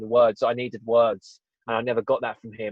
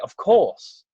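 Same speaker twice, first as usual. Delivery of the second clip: emphatic of course; 2 semitones higher, 5 dB louder, slower than usual. Retold or imitated. Insists he loves his son.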